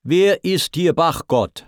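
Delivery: commanding storyteller's shout; demanding. A narrator's reading. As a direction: call out imperiously. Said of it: "heischte seine Stimme".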